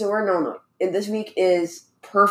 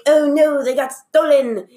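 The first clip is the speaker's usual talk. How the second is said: high, louder mock British lament; British accent; 8 semitones higher, 3 dB louder than usual. Retelling the lady finding masks stolen, hammed-up British accent. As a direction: imitate a dismayed British lady, pitched way up. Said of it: "why she has a British accent now".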